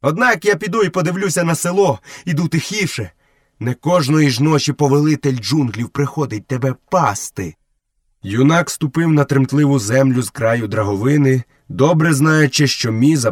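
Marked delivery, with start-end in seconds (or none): 0.0-7.6 lightly voiced taunt, pitched high; a hint of lordly swagger; haughty young jungle lord. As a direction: talk down to the buffalo in a raised pitch.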